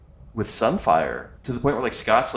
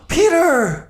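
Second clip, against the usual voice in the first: high, loud scandalized cry; shocked gasp; 4 dB louder than usual. Real, not acted. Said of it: "shocked gasp at story".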